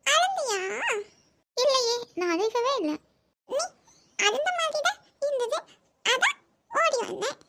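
put-on high, lisping little girl talk; child voice. Little niece teasing, high childish voice. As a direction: play the small niece teasing her aunt. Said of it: "என்ன தமிழ் சொன்ன?".

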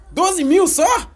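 very high, slow disbelief; only that much?; indignant surprise. Real, not acted. Disappointed by small prize.

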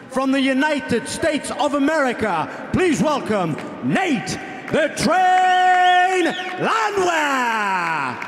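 booming drawn-out fighter introduction; ring announcer. Acted. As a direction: stretch the name out like a ring announcer.

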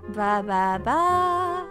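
ordinary talk, nothing marked

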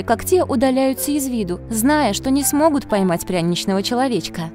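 usual speaking voice

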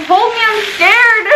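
high, slow whimpering plea; scared little baby. Retold or imitated. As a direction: play it like a frightened small child. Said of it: "I felt like such a little baby".

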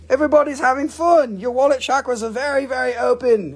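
high, quick, loud excitement; excited cheering. Real, not acted. Seller cheers on buyers having fun, high excited rush. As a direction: hype up the crowd, high and fast.

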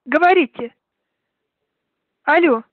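tinny phone line voice; over the phone. Real, not acted. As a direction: heard through a phone speaker. Prank call, woman answers.

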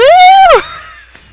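high, loud squealing laugh; delighted laugh. Real, not acted. Fireworks go off.

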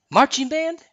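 eager queen asking; Elsa's voice. Acted. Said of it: "Elsa said".

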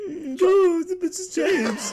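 high teasing giggle; laughing. Acted. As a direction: laugh through the words, high.

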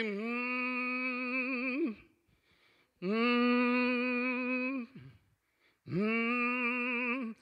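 soft closed-mouth moaning hum; low hummed moan. Retold or imitated. Aunt at hospital bed, moaning soft hum. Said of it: "She just kept moaning".

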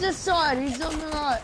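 slurred callout with a numb mouth; numb face from the doctor. Real, not acted. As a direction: call it out through a numb face. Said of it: "so I'm talking kinda weird right now".

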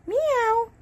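high run of meows; playful human meowing; cat imitation. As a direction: mimic a kitty in a high voice.

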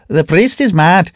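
lively bazaar trader speaking up; horse dealer. A narrator's reading. Horse dealer voiced in story.

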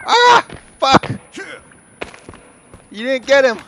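high frightened yell; startled scream. Real, not acted.

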